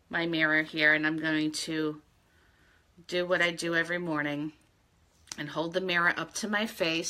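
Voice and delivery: usual speaking voice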